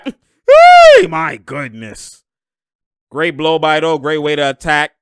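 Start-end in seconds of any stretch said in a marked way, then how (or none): 0.0-2.2 very high, very loud cheer; excited whoop. Real, not acted. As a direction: whoop it out at a great drive. Cheering a blow-by.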